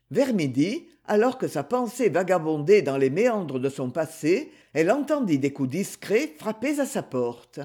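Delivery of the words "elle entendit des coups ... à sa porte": ordinary delivery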